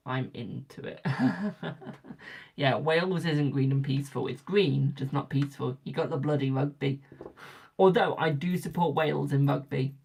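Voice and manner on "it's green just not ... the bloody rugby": ordinary talk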